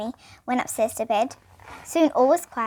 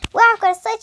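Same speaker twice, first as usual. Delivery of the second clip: put-on excited child; 9 semitones higher, 8 dB louder, faster than usual. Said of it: "said James".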